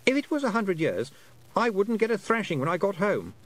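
put-on high, lightly boyish voice, puzzled; a lost boy working out how long he's been away; young boy Roland, unsure. A narrator's reading. Lost boy telling Tiffany about time, high and puzzled.

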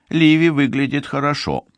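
usual speaking voice

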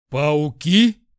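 loud startled squeal, rising question. A narrator's reading. Lad jumps at hearing of spiders, squealed. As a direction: shriek it back in disbelief. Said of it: "взвизгнул Жюль".

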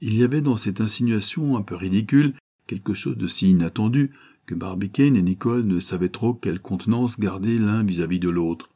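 ordinary delivery, nothing marked

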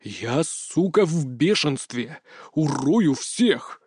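very slow, controlled rage; drawn-out, menacing anger; seething fury. A narrator's reading. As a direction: grind out the threat word by word. Seething admission of fury after denial.

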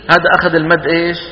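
usual speaking voice

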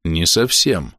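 restrained, grudging correction; through gritted teeth. A narrator's reading. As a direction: squeeze the words out coldly. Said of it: "процедил Камбис".

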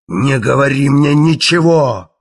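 slow booming voice that rolls down a corridor; booming thunder and wind. A narrator's reading. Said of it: "Голос Керка громом раскатился".

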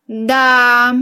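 long, stretched-out musing word; drawn-out. A narrator's reading. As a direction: draw the vowel right out, musing. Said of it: "протянула я".

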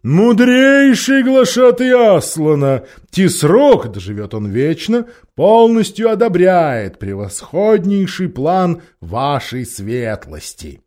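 slow, loud grand courtly voice; bow and flatter with great pomp; pompous Tarkaan. Acted. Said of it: "в напыщенной тархистанской манере".

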